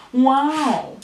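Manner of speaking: plain reading voice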